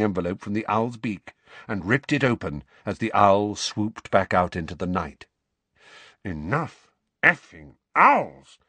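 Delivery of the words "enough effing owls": slow, exasperated grumble; distracted grumbling. A narrator's reading. Uncle fed up with owls flying in, distracted grumbling. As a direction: grumble it heavily, word by word. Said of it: "muttered Uncle Vernon distractedly".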